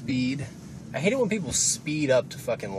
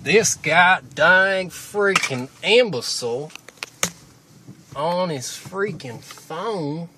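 higher, louder, slow mock-angry driver; imagined angry driver; 6 semitones higher, 8 dB louder, much slower than usual. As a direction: stretch it out like a cartoon grump. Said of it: "That's how everyone talks to me in my brain".

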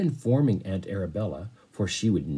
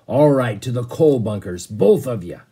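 much louder, booming command; booming captain; 3 semitones higher, 10 dB louder than usual. Acted. Sea captain sends boy and butler to the coal bunkers, booming command. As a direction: boom the order across the cabin.

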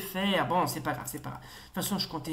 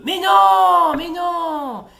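long, high, loud wail; outraged protest; 11 semitones higher, 14 dB louder, much slower than usual. Real, not acted. Player can't believe the game failed him, outraged protest. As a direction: wail it loudly, drawn out and high.

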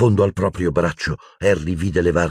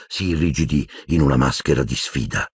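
usual speaking voice

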